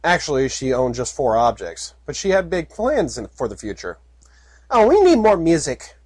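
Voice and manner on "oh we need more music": filly voice. Acted. Puppy wants music.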